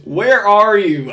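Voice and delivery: loud, high, drawn-out mock Ohio accent; Alonzo's thick Ohio accent. Commentator mimicking a drunk decoy-sting suspect, thick Ohio accent. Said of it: "'cause he goes".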